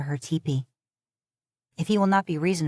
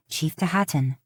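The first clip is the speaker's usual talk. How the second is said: ordinary delivery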